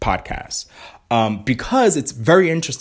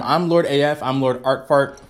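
ordinary delivery

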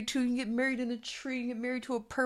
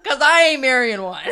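louder, higher, slow retort; defiant retort; 6 semitones higher, 12 dB louder, slower than usual. Retold or imitated. Daughter talking back to her mother, louder. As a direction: fire it back at mom. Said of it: "I was like".

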